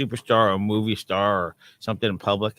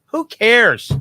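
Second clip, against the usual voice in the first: loud, high scoffing; loud dismissive who cares; 11 semitones higher, 9 dB louder than usual. Real, not acted. Co-host scoffing loudly at celebrity gossip, loud dismissive.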